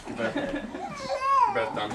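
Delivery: put-on high squeaky baby-talk voice. Parent playing with baby.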